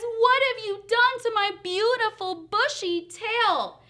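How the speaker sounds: high, loud accusing wail; outraged cry. Acted. Bear to Fox about his lost tail, loudly aghast. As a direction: demand an answer at full voice.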